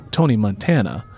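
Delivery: plain reading voice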